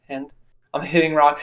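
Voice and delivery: ordinary delivery, nothing marked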